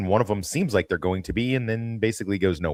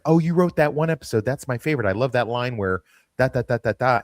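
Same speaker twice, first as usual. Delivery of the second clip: gushing fan; 6 semitones higher, 3 dB louder than usual. Retold or imitated. Fans gushing to the writer.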